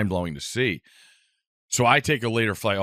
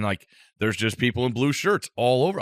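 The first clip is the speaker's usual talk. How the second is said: ordinary talk, nothing marked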